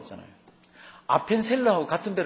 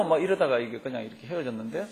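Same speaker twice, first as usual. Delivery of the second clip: usual speaking voice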